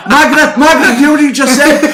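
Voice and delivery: high, loud laughing grandma calling out; shocked grandma. Retold or imitated. Grandma calls her daughter over, loud and shocked. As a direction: mimic a scandalised gran shouting for her daughter. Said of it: "She's like, at my mother".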